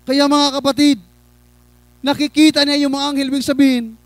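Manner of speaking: high, projected sermon voice; impassioned preaching. Real, not acted. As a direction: put the challenge to the congregation from the pulpit. Preaches to congregation. (masidhing pangangaral)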